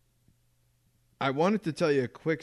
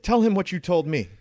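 higher, louder retold demand; imitated insistent dad; 7 semitones higher, 6 dB louder than usual. Son imitates dad prodding him before a friend, raised insistent voice. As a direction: press the point in a raised voice.

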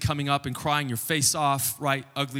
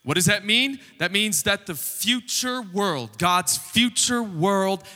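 emphatic preaching; 4 semitones higher than usual. Real, not acted. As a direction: proclaim it to the congregation, leaning hard on key words. Preaches the arrival of God's kingdom.

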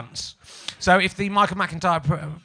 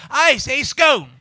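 higher, louder, slow cry of "scone" with a long o; retold shout; 7 semitones higher, 7 dB louder, much slower than usual. Woman at the back shouting her pronunciation, imitated. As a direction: call out "scone" rhyming with stone. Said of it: "a woman at the back shouted out".

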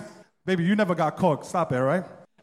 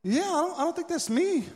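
higher-pitched, hesitant denial; mock-innocent, voice pitched up; feigned denial; 7 semitones higher than usual. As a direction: play dumb while caught red-handed. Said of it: "you still try to deny it".

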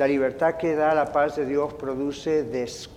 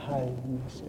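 much softer, lower "cálmese"; soft hush; 3 semitones lower, 11 dB quieter than usual. Shown as a demonstration. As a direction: let it sink in softly. Pastor soothing the congregation.

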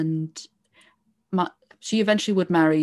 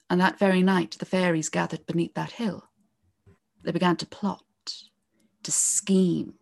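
hushed storyteller whisper. Acted. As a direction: let the voice drop to a secretive whisper. Fairies plotting beneath the hill.

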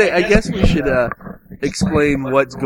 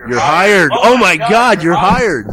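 loud, delighted mock hiring; hammed-up excited boss; excited outburst; 3 semitones higher, 6 dB louder, slower than usual. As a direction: blurt it out loudly, won over at once. Mock interviewer thrilled by the answer.

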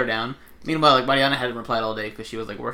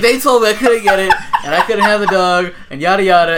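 high, loud tattling through laughter; hammed-up imitation of her protest; mock complaint; 8 semitones higher, 11 dB louder than usual. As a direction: whine it like an aggrieved kid, amused. Voicing roommate complaining.

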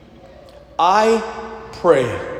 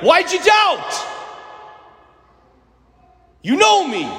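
harsh scolding; 11 semitones higher than usual. Pretend. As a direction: snap at him like an impatient master. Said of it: "Jesus could have looked at him and been like".

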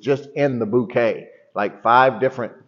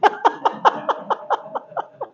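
high breathy laugh. Real, not acted.

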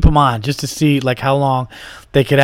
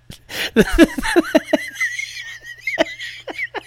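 high burst of laughter; 3 dB quieter than usual. Real, not acted. Breaks up laughing.

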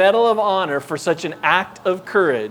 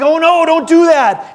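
higher, louder pleading with a laugh; alarmed plea; 10 semitones higher, 7 dB louder than usual. Retold or imitated. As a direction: beg the dog to stop in a panic. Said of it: "I'm like".

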